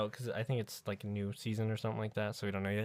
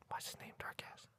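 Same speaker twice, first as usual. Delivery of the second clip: whisper; 17 dB quieter than usual. Pretend. Mock secret whisper reply.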